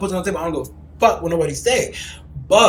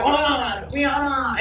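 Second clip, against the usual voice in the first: screaming fangirl; 8 semitones higher, slower than usual. Acted. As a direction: cry the star's name high, like a starstruck fan. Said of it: "You were that girl motherfucking crying and screaming".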